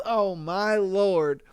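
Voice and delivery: ordinary talk, nothing marked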